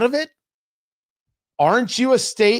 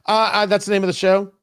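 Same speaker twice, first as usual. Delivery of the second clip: usual speaking voice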